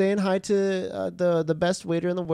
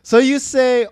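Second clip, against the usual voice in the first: normal talking voice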